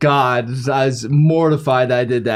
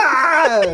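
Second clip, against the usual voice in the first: high mock scream back; yelled Ah; 13 semitones higher, 4 dB louder than usual. Son yelling back at his dad, a mock scream. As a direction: yell it straight back. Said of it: "I was like".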